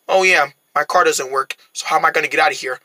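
usual speaking voice

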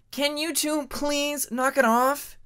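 a dragoness breaking up a squabble; exasperated Hyena. Acted. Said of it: "came a hyena's voice".

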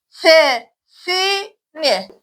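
"Sesini" drawn out again, very slow and even; very slow syllables. Shown as a demonstration. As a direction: stretch every syllable of "Sesini" very slowly. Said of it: "Arkadaşlar tekrar okuyalım".

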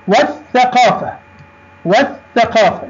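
والثقافة twice, no l and a held th; teacher demo, double the th in والثقافة; silent lam. Shown as a demonstration. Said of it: "لم ننطق اللام". (نطق «والثقافة» بلام صامتة وثاء مشددة)

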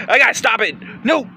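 normal talking voice

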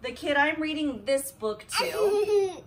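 loud, giggling read; can barely read for laughing; laughing through words. Real, not acted. Mom reading to Harper, laughing through the compliment.

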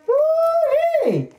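high, gleeful woo-wee; delighted whoop. Real, not acted. Pulling out the Cheetos for viewers, gleeful high whoop. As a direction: whoop with pure glee at the snack.

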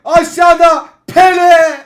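slow, loud excited call; excited shout. Real, not acted. Calling the play excitedly.

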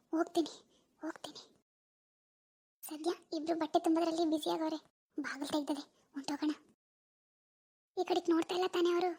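hushed, low talking to oneself; furtive undertone. Acted. Girl slipping out past her parents, hushed and furtive. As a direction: keep it very soft so nobody hears. Sneaking out of home unseen.

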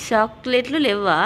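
చాక్లెట్లు లేవా drawn out slowly and clearly; careful pronunciation. Shown as a demonstration. Adult correcting a small child's chocolate words, careful pronunciation. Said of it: "నువ్వు సరిగ్గా పలకట్లేవు".

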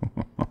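short, soft dismissive chuckle; mocking villain chuckle. Demon ruler correcting the hero, soft mocking chuckle. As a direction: chuckle quietly at their mistake. Villain mocks hero's assumption.

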